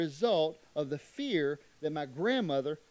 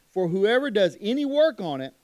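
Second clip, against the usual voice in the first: loud, forceful reading of "work"; very emphatic; 3 semitones higher, 8 dB louder than usual. Acted. Preacher pressing God's command on the listeners, loud and emphatic. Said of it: "Very emphatic".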